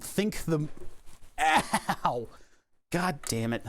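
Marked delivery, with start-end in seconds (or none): 1.4-2.3 pained yelp. Real, not acted. Hurts hand on connector post.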